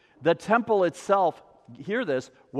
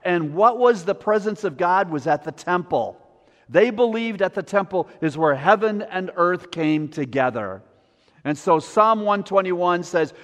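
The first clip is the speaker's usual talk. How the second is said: normal talking voice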